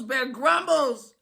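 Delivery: ordinary delivery, nothing marked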